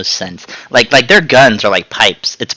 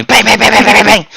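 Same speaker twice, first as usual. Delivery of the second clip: rapid, high mock gunfire; imitated gunfire; 11 semitones higher, 5 dB louder than usual. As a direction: rattle off pretend shots, pitched up and loud. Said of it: "the other guys start going".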